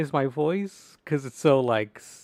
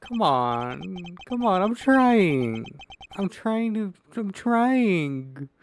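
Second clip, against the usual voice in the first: straining effort; 5 semitones higher, much slower than usual. Real, not acted. Struggling with a game task.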